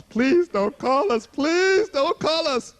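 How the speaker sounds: begging slowly not to be called; slow pleading prayer. Retold or imitated. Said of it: "I'm saying".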